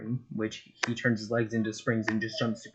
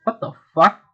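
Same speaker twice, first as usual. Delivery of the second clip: shocked yell; 10 semitones higher, 8 dB louder than usual. Acted. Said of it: "Buggy's like".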